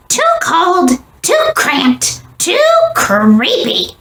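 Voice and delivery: high, small squeak of a complaint; squeaky mouse voice. A narrator's reading. Mouse grumbling about his cold hole, squeaky. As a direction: squeak it out like a fussy little mouse. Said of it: "squeaked Mortimer".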